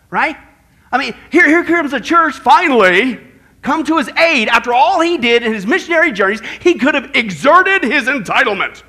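ordinary talk, nothing marked

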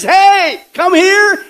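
loud, high mock call-out; mock advertising call. Preacher mimics churches advertising on their signs, loud mock call.

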